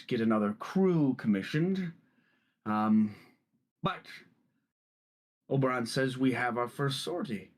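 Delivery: lightly voiced boisterous captain; hearty captain greeting. Captain North greets Ethan, hearty and lightly voiced. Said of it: "and he's like".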